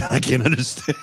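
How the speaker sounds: high, loud words through laughter; helpless amused outburst; laughing. Real, not acted. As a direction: crack up while saying it. Cracks up at Middlesbrough accent.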